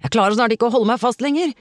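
fast, loud, high-pitched plea; urgent shout. A narrator's reading. Tore slipping from the rock, urgent shout. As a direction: shout it quickly as if losing grip. Man losing grip in sea.

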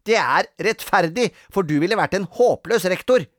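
high, loud put-down; cross, superior child; bossy retort. A narrator's reading. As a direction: shut down a rival child. Grete argues back.

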